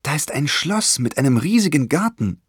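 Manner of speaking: lightly acted excited call; sudden calling out. A narrator's reading. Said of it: "rief Sophie auf einmal".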